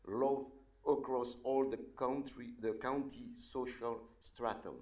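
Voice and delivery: earnest public prayer with sudden swells on the calls to God; invocation prayer. Acted. Pastor praying over county leaders, earnest prayer voice. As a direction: pray over the leaders, lifting each appeal.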